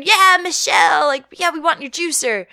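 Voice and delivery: a crowd member cheering on stage; mock cheering. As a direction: whoop it up like a fan.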